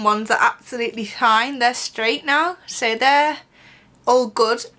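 talking with fingers at mouth; lip pulled down by fingers. Real, not acted. Seen 1: both index fingers pull lower lip down, baring bottom teeth.

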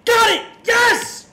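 screaming in victory, very high and loud; triumphant scream. Real, not acted. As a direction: yell out the win at full blast. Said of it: "I fucking screamed so hard".

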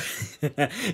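brief chuckle after an outburst; short laugh. Acted. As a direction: let out a quick laugh. Laughs between exclamation and denial.

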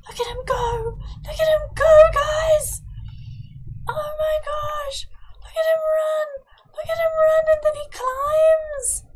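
very high-pitched gushing squeal; squealing delight. Real, not acted. Player watching her little boy run and climb, squealing with delight. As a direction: squeak it out, overcome by cuteness.

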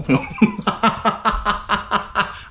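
long high cackle in a steady staccato; rapid-fire ha-ha-ha; boastful laughing. Real, not acted. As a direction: laugh heartily at your own bragging. Boasts jokingly, bursts out laughing.

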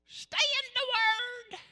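put-on high, slow old timer's quaver; very old faint voice. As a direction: imitate an old church elder. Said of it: "they would always say".

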